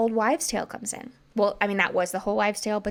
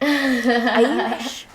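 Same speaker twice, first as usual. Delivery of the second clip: put-on laughing, louder try at an Irish accent; failed Irish accent; 3 semitones higher, 6 dB louder than usual. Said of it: "I don't know why I said it like that".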